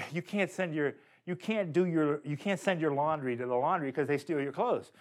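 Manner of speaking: ordinary talk, nothing marked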